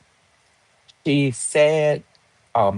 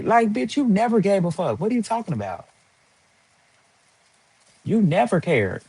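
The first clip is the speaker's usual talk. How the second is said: higher, exasperated calling out; indignant; 8 semitones higher than usual. Real, not acted. Calling out the ex-friend's hypocrisy, high and exasperated. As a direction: push it up in pitch, fed up.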